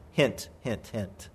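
low, soft sly aside; quiet, knowing nudge; hinting aside. Acted. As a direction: drop the voice right down to hint.

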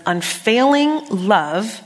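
slow, savoring each word; drawn out. Acted. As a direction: linger on Unfailing love. Savoring key scripture phrase.

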